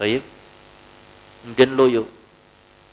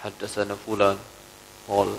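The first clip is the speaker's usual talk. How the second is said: lower, softer, limp reading of the hadith chain; imitating the scholar's tired, half-hearted delivery; listless recital; 5 semitones lower, 4 dB quieter than usual. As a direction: mumble it as if drained of energy.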